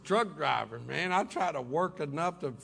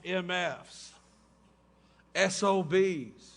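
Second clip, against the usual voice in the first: spelled out slowly; much slower than usual. Retold or imitated.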